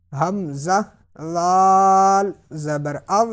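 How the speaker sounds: tongue-tip th sound as in "this", no sibilance in "لال"; no whistle. Shown as a demonstration. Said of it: "سیٹی کی آواز نہیں نکالنی".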